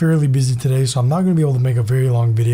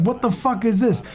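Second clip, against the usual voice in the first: high, indignant protest; mock outraged kid; 8 semitones higher, 3 dB quieter than usual. Vlogger voicing the handcuffed kid, high and indignant. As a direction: voice the cuffed kid, higher and disbelieving.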